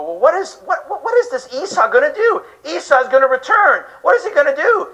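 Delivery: high, half-laughing puzzlement; baffled question. Retold or imitated. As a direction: ask it as if it makes no sense. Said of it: "So you go".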